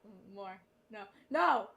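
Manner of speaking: usual speaking voice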